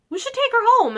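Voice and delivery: eager suggestion. Retold or imitated. Mom retelling the orphanage visit, eagerly suggesting. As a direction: pitch up as if struck by a lovely idea. Retold mom deciding to adopt.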